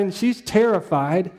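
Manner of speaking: normal talking voice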